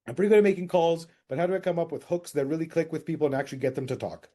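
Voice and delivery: quick, rushed question read; fast reading. Retold or imitated. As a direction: rattle off the question.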